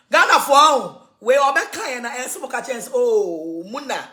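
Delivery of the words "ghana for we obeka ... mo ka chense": ordinary talk, nothing marked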